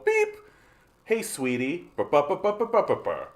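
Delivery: a lightly voiced cute robot, sweetie then burrs; AI companion voice.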